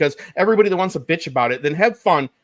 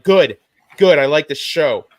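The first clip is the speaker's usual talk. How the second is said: loud, lightly voiced nerd; imitated nerd; 8 dB louder, slower than usual. Mocking a lone nerd watching at home, loud imitated approval. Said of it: "there's one nerd sitting at home".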